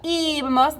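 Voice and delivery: ibamos with the opening I high and drawn out; stress on first I. Shown as a demonstration. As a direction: stretch and lift the first syllable of ibamos. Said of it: "There's an accent on that I there".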